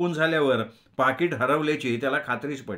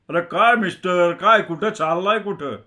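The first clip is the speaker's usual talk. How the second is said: loud, raised confronting voice; aggressive challenge; 4 semitones higher, 5 dB louder than usual. Acted. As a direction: accost him roughly, loud and high. Aggressive man accosts the narrator.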